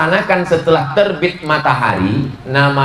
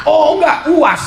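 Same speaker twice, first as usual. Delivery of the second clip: surprised correction; 12 semitones higher than usual. Real, not acted. Correcting himself about the speaker.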